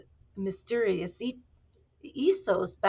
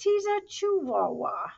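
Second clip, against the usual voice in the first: excited storyteller; 10 semitones higher, 9 dB louder than usual. A narrator's reading. Excited intro of the cat.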